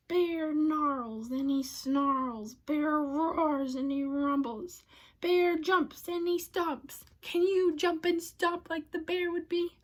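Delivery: plain reading voice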